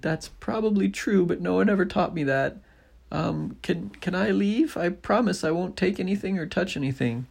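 put-on high girl's voice, nervous; high, quick anxious little girl; Nellie pleading. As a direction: ask timidly to be let go.

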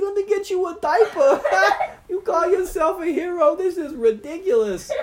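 very high, loud laughing taunt; laughing squeaky mockery. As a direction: tease him through giggles. Stoogie mocking, laughing.

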